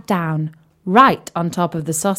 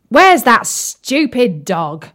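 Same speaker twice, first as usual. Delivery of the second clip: slow, loud cross shout; angry shout; 3 semitones higher, 6 dB louder, slower than usual. Acted. Palace cook shouting for the dog, angry. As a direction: bellow it like a furious cook. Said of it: "shouted the cook".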